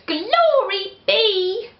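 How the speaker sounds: put-on high, fretful old lady lament; old lady at her wits' end; old lady crying out. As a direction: wail it as a flustered old lady. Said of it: "The little old lady cried".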